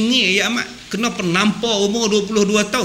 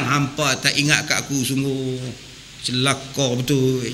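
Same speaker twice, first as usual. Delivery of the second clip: grumbling; 8 semitones lower, 7 dB quieter than usual. Acted. Guest grumbles at hosts.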